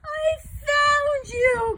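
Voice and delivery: very high, loud and slow, beaming; happy cry. A narrator's reading. Boy finally finding the real Yeti, happy cry. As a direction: stretch out the joy with a grin. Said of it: "cried George with a big happy grin".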